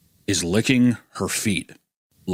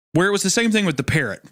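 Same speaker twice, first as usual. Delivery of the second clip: ordinary talk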